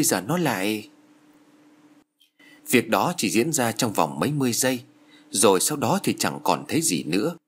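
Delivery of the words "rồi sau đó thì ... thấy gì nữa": ordinary delivery, nothing marked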